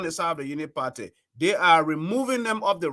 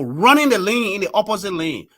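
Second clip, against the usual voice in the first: ordinary delivery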